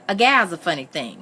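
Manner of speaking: usual speaking voice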